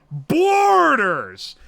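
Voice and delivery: loud, drawn-out cry, rising then falling; exclaiming. Real, not acted. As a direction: call it out big, stretching the first syllable. Exclaims at the guessing game's hint.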